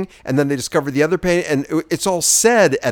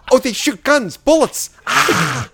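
Mickey Rooney caricature; 10 semitones higher, 6 dB louder than usual. Acted. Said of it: "I'm doing Mickey Rooney in Breakfast at Tiffany's".

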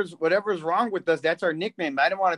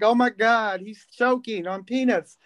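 higher-pitched, flamboyant cry of alarm; flamboyant flight attendant; 5 semitones higher than usual. Retold or imitated. Comic retelling of a steward spotting a choking passenger, flamboyant. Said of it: "the very flamboyant flight attendant".

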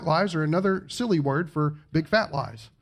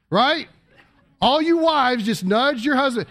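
ordinary talk

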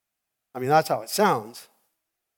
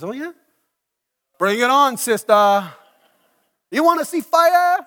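much higher, louder brash taunt; cocky challenge; 9 semitones higher, 10 dB louder than usual. Retold or imitated. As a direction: dare her to come at him. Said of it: "and he goes".

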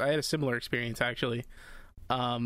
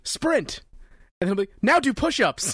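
barked command; 8 semitones higher, 6 dB louder than usual. Retold or imitated. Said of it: "it'll be like".

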